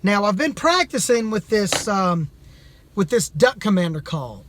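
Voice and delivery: ordinary talk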